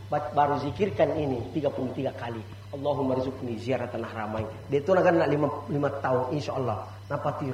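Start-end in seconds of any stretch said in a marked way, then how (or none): 2.7-4.4 low devout recitation; recited prayer. Shown as a demonstration. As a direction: intone it quietly, unhurried. (melantunkan doa dengan suara rendah)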